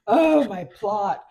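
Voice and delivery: loud, slow, drawn-out lament; hammed-up writer's moan; mock whiny writer. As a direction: moan about the plot like a drama queen. Mocking a fussing writer.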